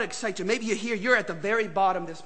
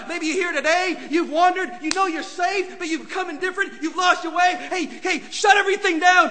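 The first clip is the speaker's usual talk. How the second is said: high, louder impassioned preaching ending in a shout; preaching at full force; 10 semitones higher, 6 dB louder than usual. Acted. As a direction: plead and then cry out.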